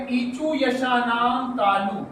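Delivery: slowly intoned sutra, every syllable spaced out; deliberate chant-like recitation. Shown as a demonstration. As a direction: recite the rule slowly for the class to copy.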